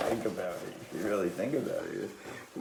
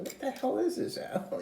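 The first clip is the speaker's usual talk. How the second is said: retold exasperation. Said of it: "I said".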